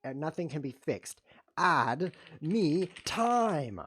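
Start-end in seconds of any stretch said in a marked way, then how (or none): 1.6-3.8 drawn-out plea. Real, not acted. Pleading, drawn out.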